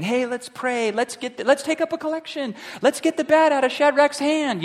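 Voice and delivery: high, light, flustered church folk; mock fussing helpers. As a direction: lightly mimic panicked helpers. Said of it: "people running around you saying".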